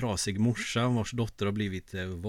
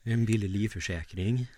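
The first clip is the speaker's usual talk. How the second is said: driver's notice in Värmland dialect; broad Värmland accent. Acted. Co-host mimics driver about seatbelts, broad Värmland accent. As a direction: imitate a Värmland bus driver. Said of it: "han pratar på väldigt bred värmländska".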